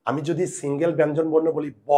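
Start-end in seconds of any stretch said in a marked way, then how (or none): 1.9-1.9 ব said as open "baw"; ব held as its own open syllable; inherent vowel sounded. Shown as a demonstration. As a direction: let "ô" follow the b in ব. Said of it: "একটা অয়ের উচ্চারণ হয়".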